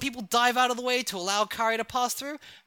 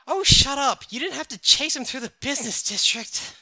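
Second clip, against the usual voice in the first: annoyed; 6 semitones higher, 5 dB louder than usual. Acted. Kari annoyed at brother.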